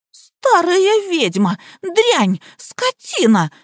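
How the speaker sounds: drawn-out high-pitched abuse; high yelling. A narrator's reading. Liza keeps abusing Nika, yelling. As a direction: wail the insults high. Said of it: "продолжала вопить Лизавета".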